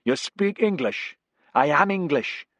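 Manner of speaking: calling out. A narrator's reading. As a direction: call across to her. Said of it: "He called".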